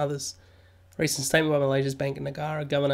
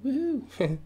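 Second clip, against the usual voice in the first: cheer; 10 semitones higher, 3 dB quieter than usual. Real, not acted. Cheering the viewer count.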